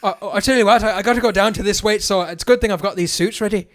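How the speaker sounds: lightly put-on Irish accent; hint of a Conor McGregor Irish accent. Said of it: "I was gonna do an Irish accent".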